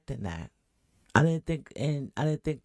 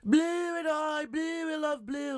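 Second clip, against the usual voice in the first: high, slow, dreamy gush over Blue; mock her lighting up at the name; much higher giddy swoon, mocking her; perked-up gushing; 12 semitones higher, slower than usual. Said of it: "She perks right up".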